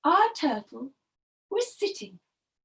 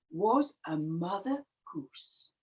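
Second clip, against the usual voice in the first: ordinary delivery, nothing marked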